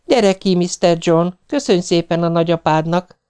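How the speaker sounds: high-pitched talk to a pet; coaxing a cat. A narrator's reading. Wife talks to the cat.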